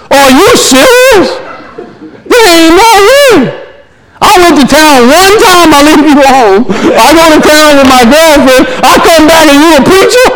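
imitating a wife's high-pitched disbelief, half laughing; high, comically scandalized wife; incredulous wife. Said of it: "And she said".

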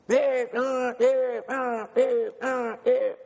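high chanted marching cadence; drill cadence call. Retold or imitated. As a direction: chant the count like a drill corporal. Corporal calling marching cadence.

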